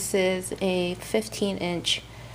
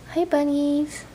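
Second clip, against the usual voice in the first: cute pet greeting; 7 semitones higher, slower than usual. Real, not acted. Greeting her pet rabbits.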